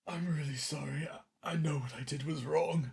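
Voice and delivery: soft, contrite confession; apologetic. Acted. Caught thief apologizing to those he robbed, soft and sorry. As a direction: own up quietly and sorrowfully.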